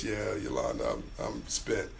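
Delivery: real hoarseness, drops low and soft, voice nearly gone; worn-out hoarse voice. Real, not acted. Viewer remarks on his strained voice, answers hoarse and drained. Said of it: "oh my God, your voice".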